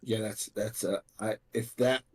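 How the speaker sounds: halting, repeated false starts; stuttering. Real, not acted. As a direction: fumble for words, restarting each phrase. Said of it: "He's stuttering".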